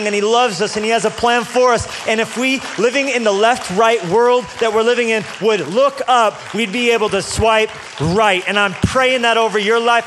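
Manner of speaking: impassioned pastor praying over the crowd; full-force preaching. Acted. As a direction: preach it out over the room with rising fervor.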